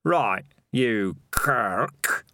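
very slow, drawn-out challenge; loud challenge. A narrator's reading. Dark Tan challenging Jacko before the watchers, projected challenge. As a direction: spell it out word by word for onlookers. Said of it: "loud enough for the watchers to hear".

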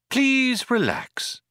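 calm them like an airline stewardess; the ship's voice soothing the passengers; pleasantly. Acted. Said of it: "said the voice pleasantly".

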